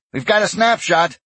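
crisp order to the bridge crew. A narrator's reading. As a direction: call it out as a commander's order. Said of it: "Aleska ordered".

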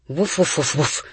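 quick, voiced dog woofs; dog barking. A narrator's reading. A bounding dog announcing himself after his bath, barking. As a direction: bark out a run of woofs. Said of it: "Franz broadcast".

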